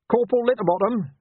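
high, loud summons; yell. A narrator's reading. Flustered officer summons his corporal, high yell. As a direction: call out sharply for the corporal. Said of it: "he yelled to cover his confusion".

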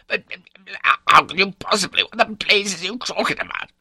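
high, loud, agitated spluttering; splutter, then explode at the wife; crabbit outburst. A narrator's reading. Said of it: "take that crabbit tone".

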